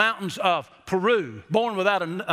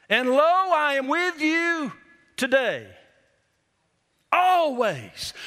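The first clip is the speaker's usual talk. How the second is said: solemn scripture quote; 5 semitones higher, slower than usual. Acted. Pastor quoting the Bible verse.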